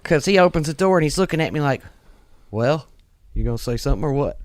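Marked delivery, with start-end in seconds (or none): 2.5-4.4 lower-voiced impression of a cocky cop; cop with attitude. Buff young cop to pulled-over trucker, mock attitude. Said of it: "he's got an attitude".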